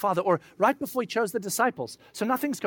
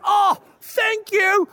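much higher, louder, slow gushing thanks; overjoyed relief; 13 semitones higher, 8 dB louder, much slower than usual. Retold or imitated. Friend thanking the Spirit in the restroom, gushing relief. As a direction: burst out with grateful relief.

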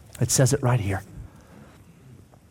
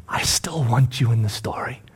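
hushed, breath-led whisper; tender reassurance; 3 semitones lower, 3 dB quieter than usual. Acted. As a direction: whisper comfort to a friend. Jesus reassures Peter.

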